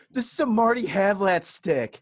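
mock crying teen voice; mock crying kid. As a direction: imitate a crying kid, slow and whiny. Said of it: "he starts crying".

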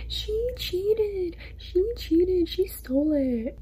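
high-pitched whiny fake sobbing; mock crying. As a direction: imitate a crybaby wailing an accusation. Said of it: "has the audacity to start crying".

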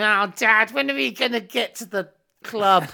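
high, loud mock child whine; whiny kids. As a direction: imitate the kids nagging dad. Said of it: "your kids going".

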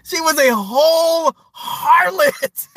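high, drawn-out and emphatic, tipping into laughter; laughing emphasis. Real, not acted. Judging Jenny to the audience, gleefully drawn out. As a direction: stretch the last words slowly, then crack up.

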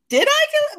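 high mock-confused voice; mock Tiffany. Fan imitating Tiffany's confusion, high and bewildered. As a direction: play it dumbfounded and innocent. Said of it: "Because Tiffany's like".

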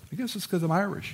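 quick high quip as Red; quoting Red. Retold or imitated. Pastor quoting Red's baseball joke, quick high quip. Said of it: "he goes".

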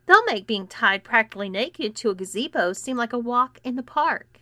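ordinary delivery

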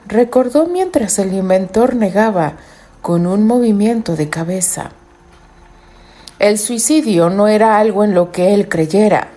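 measured storyteller reading; story narration. A narrator's reading. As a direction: read it like a novel.

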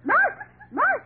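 high excited shout; shouting march. Acted. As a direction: call out march loudly. Calling the gang to march.

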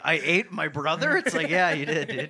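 loud, laughing voiced exchange; shouting a silly quote while cracking up; laughing mock quote. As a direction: blurt it out through laughter. Acted dialogue while laughing.

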